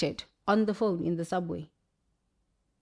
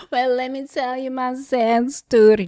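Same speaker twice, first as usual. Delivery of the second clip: mocking whine; 4 semitones higher, 4 dB louder than usual. Mocking self-pitying people.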